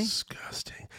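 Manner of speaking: whispered high and breathless; disgusted whisper. Real, not acted. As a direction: hiss it out in disgust. Recalling canned beets with disgust.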